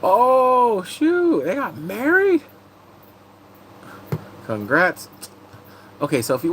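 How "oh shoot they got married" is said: high, loud shocked gasp. Real, not acted. Streamer reacting loudly to surprising news.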